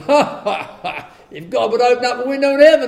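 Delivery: hammed-up scornful cackle; mocking laugh. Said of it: "scoffed and mocked".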